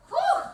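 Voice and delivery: loud, high whoop of a whew; excited whew. Real, not acted. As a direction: cheer the class on with a whew. Cheering on during the set.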